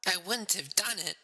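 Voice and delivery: tearful woman at confession; woman confessing. A narrator's reading. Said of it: "She was weeping".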